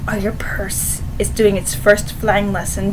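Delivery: threatening whisper; menacing whisper. Pretend. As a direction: hiss the threat close to her ear. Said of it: "I'd be like".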